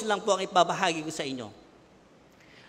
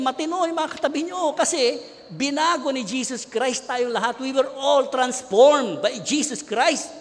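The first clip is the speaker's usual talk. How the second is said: higher, louder, slowing to a ringing climax; preaching at full force; 5 semitones higher, 5 dB louder, slower than usual. Acted. Preacher rousing congregation after amen, full force preaching. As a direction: lift the room, preach it out.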